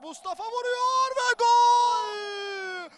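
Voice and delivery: stretched goal scream; screaming football commentator. Real, not acted. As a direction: yell the goal long and loud. Goal call, live commentary.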